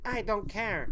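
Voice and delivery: high, different voice for the dismissive aunt; imitating aunt. Nephew mimics aunt brushing off the points. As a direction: toss it off in a put-on high aunt voice. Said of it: "She's like".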